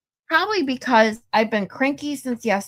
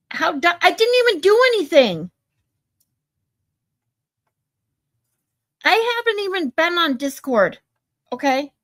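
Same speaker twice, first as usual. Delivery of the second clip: higher, louder frustrated outburst; venting at an unfair timeout; indignant raised voice; exasperated yelling; 8 semitones higher, 4 dB louder than usual. Real, not acted. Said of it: "[name] is yelling at me again".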